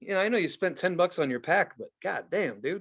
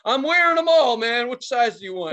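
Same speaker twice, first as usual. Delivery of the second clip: much higher, loud goofy seller call; hammed-up merch guy; 6 semitones higher, 6 dB louder than usual. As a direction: pitch shirts like an eager bootlegger. Imagined tool fan seller voice.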